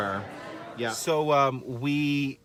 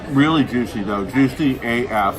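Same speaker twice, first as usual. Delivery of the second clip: mouth full; 3 dB louder than usual. Real, not acted. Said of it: "Talking with my mouth full".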